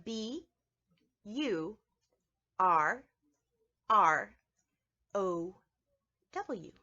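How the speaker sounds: letter by letter, slow and clear; teacher spelling out a word. Shown as a demonstration. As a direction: spell each letter with a pause. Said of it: "First one is burrow".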